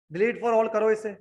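ordinary talk

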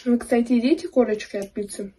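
chewing while talking; speaking between bites; mouth full. Real, not acted. Seen 1: Bites pizza at -3.0; holds slice, mouth moving through the words.

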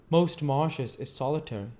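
a hint of easygoing Joe; Joe the blacksmith. A narrator's reading. Joe answers.

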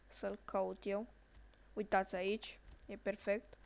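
ordinary talk

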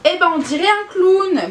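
high, loud outburst of dismay; dismayed exclamation. Real, not acted. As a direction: exclaim it loudly, pitch jumping right up. Dismayed by the clownish blush.

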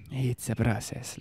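breathy half-whisper, drawn out; slow self-soothing. Real, not acted. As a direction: stretch it slowly to calm yourself. Repeats it slowly, calming down.

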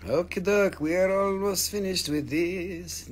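ordinary talk, nothing marked

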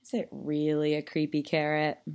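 low, soft conspiratorial question; hushed aside. A narrator's reading. Narrator asks children about the shadow, hushed. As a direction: drop low and soft to ask listeners. Spooky aside to young listeners.

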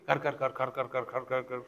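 soft, unhurried mock snore; imitated slow snoring. Shown as a demonstration. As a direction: snore it out calmly, no hurry. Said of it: "heard snoring in a hurry?".

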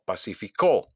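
"pacificó" punched on the last CÓ; stress on final syllable. Shown as a demonstration. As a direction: land hard on CÓ in "pacificó". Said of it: "hago un golpe de voz". ("pacificó" con golpe de voz en CÓ)

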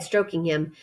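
usual speaking voice

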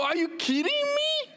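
high squeaking mock outrage; exasperated outcry. Real, not acted. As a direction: squeal it in comic disbelief. Pastor's exasperated cry at the cold.

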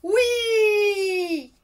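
a high, loud, happy YES; gleeful shouted yes; joyful shout. Acted. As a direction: burst out with delight. Ned finally accepts the dragon.

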